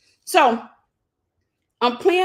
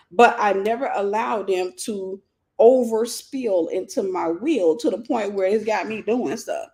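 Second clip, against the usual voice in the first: slow, deliberate. Shown as a demonstration. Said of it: "Did you catch that?".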